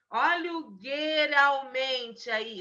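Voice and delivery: gueralmente said with a hard G, loud and slow; mock misspelling. Shown as a demonstration. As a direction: exaggerate the wrong gueralmente loudly. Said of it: "Escreve pra mim o errado".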